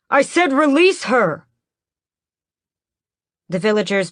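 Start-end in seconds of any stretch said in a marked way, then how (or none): 0.1-1.5 high, loud repeated command; furious shout. Acted. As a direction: yell the order, losing patience. Raoul repeats his order.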